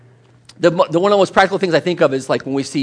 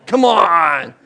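mock scorn; 6 dB louder, much slower than usual. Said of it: "You're not like".

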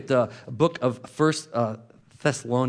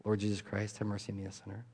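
very soft and lower; soft prayer; 5 semitones lower, 12 dB quieter than usual. Shown as a demonstration. Preacher inviting listeners to try the prayer, softly. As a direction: breathe the prayer gently. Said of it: "try that".

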